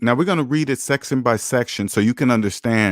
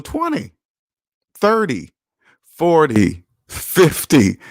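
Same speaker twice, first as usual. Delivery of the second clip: rising louder with each decade; building to loud; 3 semitones higher, 5 dB louder, much slower than usual. Real, not acted. Preacher hammering viewers on endless loan years, building loud. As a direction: climb from steady to a loud, slow sixty years.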